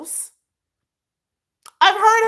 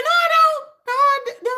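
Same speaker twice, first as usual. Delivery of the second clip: "No, I don't" much higher and louder, near a yell; shrill loud refusal; 14 semitones higher, 4 dB louder than usual. Real, not acted. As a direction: push it up to an exasperated squeal. Refusing again, escalating.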